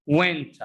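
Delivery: "Went" as one word, "wenta"; weak "tuh" tacked onto "Went"; reduced pronunciation. Shown as a demonstration. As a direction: blend it into a short "wenta". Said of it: "It's pronounced what?".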